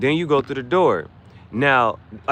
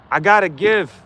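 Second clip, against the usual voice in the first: excited; 8 semitones higher, 6 dB louder than usual. Real, not acted. Reacts to a viewer gift.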